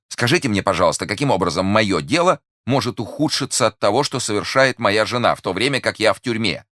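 high, agitated protesting question; exasperated. A narrator's reading. Merchant protests his wife's deeds can't harm his case, exasperated. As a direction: demand an answer, beside yourself. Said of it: "крикнул выведенный из себя купец".

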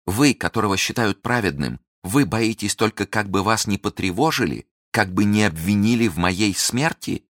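high bitter reproach; indignant reproach. Acted. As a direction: shame him for his cowardice. Milady reproaches Felton indignantly.

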